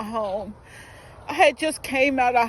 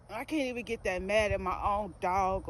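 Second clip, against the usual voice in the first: ordinary talk, nothing marked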